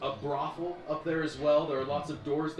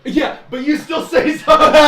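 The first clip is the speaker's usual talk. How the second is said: high, loud laughing words; amused GM cracking up; 10 semitones higher, 15 dB louder, slower than usual. Real, not acted. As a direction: laugh through the line. Laughing at player's voice.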